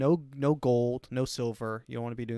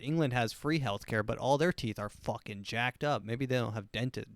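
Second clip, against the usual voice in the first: ordinary talk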